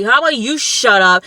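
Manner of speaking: retold heated retort; yelling match retort. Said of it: "It's a yelling match".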